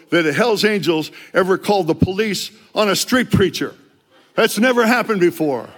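rising declaration. Retold or imitated. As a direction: announce it like history is being made. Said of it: "Finally, I said".

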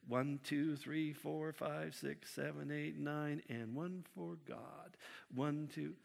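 tally each seed like a petty bookkeeper; lightly voiced pedant murmuring numbers; fussy counting. Acting out counting seeds fastidiously.

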